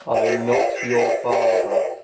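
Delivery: slow, deep helmet voice; Vader voice changer. Pretend. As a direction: voice through a Darth Vader helmet.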